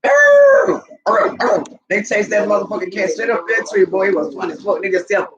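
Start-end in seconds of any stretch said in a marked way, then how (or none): 0.0-1.6 loud high howl, then short barks; bay like a dog after prey; imitated barking howl. Dogs spotting a feral cat, loud imitated baying. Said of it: "It was like".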